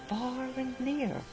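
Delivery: usual speaking voice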